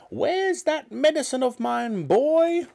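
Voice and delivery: put-on high, demanding old woman's voice; grumpy granny hollering for her medicine; cranky grandma calling. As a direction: call out like a bossy old granny. Grandma calls from living room.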